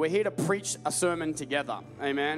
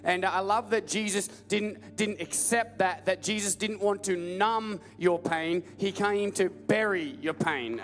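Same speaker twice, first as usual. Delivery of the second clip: ordinary delivery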